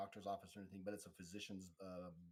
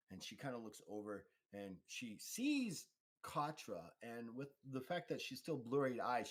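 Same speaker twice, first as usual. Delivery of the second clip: usual speaking voice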